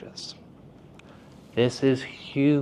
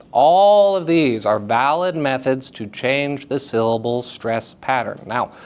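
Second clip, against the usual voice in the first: usual speaking voice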